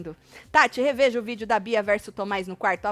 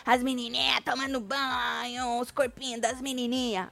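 imitating the young woman; 4 dB quieter than usual. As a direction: drag out the diminutives mockingly. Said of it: "A moça falando".

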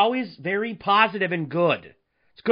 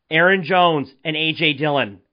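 ordinary delivery

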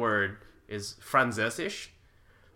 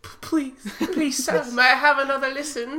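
high pleading little-orphan voice; begging orphan; 7 dB louder than usual. Pretend. Begging the podcast listeners for another listen, high pleading orphan voice. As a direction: beg like a hungry urchin asking for more.

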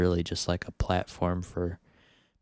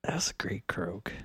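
soft unvoiced whisper; 6 dB quieter than usual. Real, not acted. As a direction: breathe the words. Whispered remark after croak.

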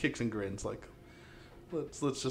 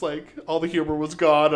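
usual speaking voice

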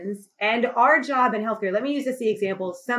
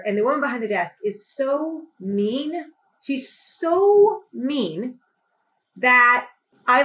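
ordinary delivery

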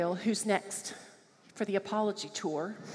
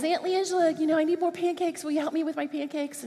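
put-on high, quick, sweet small-boy voice; little boy asking; 7 semitones higher than usual. Six-year-old nephew asking his aunt for pancake help, high and sweet. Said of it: "Gabriel comes and he goes".

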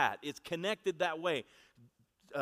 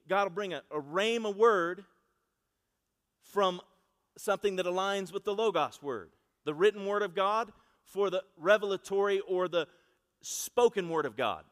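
louder, weighty sermon delivery; preaching emphasis; 4 semitones higher, 6 dB louder than usual. Acted. Preacher explaining the rhema word to the congregation, louder. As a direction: lean hard on each word.

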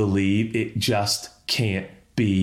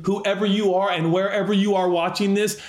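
usual speaking voice

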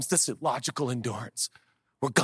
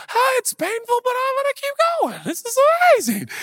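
mock strained joy; 8 dB louder than usual. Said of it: "you'll be like".

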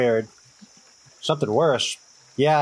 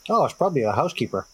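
ordinary talk

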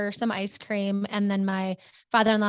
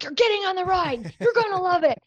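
pushy mom; 10 semitones higher, 4 dB louder than usual. Retold or imitated. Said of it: "my mom would be like".

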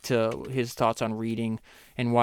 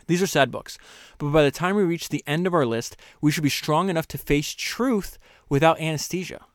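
higher, measured reading; reading aloud; 4 semitones higher, 4 dB louder than usual. Acted. Host rereading the passage to listeners, steady. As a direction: read it steadily.

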